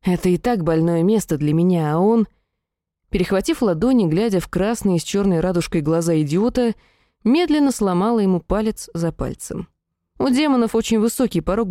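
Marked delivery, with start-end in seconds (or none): none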